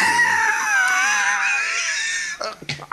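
shrill burst of laughter; high shrieking laugh. Real, not acted. Big laugh.

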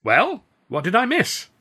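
high, slow, loud innocent voice; loud different voice. Acted. Narrator disguising himself before the stewards, loud high innocent voice. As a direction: play dumb in a put-on voice. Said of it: "I said loudly, and in a very different voice".

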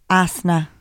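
"Asna" with no R or L, Nigerian impression; put-on Nigerian accent, final L dropped; mock Nigerian accent. Shown as a demonstration. Host demonstrating accent hack, mock Nigerian accent.

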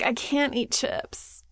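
drawn-out complaining whine; whiny voice. Retold or imitated. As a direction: whine it like a starving kid. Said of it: "in your most whiny voice that you're doing right now".

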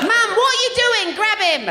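hammed-up nanny accent sliding into cockney; Super Nanny. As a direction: instruct Mum like a British TV nanny. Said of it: "Okay, do Super Nanny".

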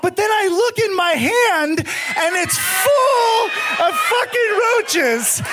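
high, slow, half-laughing panic; panicked retelling. Retold or imitated. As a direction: relive the freak-out with a high yelp. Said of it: "And I'm freaking out".

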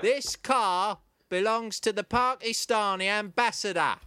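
measured Cockney impersonation; Michael Caine. Acted. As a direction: draw it out deliberately, word by word. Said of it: "impression of him".

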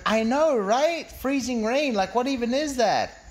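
put-on high-pitched, agreeing girl talk; imitated woman's exasperated small talk; chatty woman. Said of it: "And she went".

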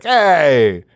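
hammed-up Italian-American skirt-chaser; Italian dude impression. Imitating Jimmy hitting on women, hammed-up Italian dude voice. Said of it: "Italian dude would be like".

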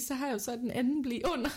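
normal talking voice

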